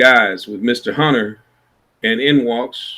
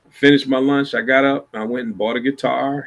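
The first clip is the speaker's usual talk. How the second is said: normal talking voice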